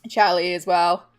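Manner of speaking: ordinary delivery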